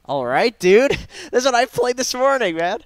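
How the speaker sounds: loud happy outburst; thrilled, laughing shout; excited. Real, not acted. Excited about his hero.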